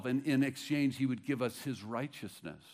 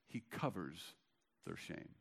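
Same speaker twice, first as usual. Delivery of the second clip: hushed; 5 semitones lower, 10 dB quieter than usual. Acted. Repeats key line.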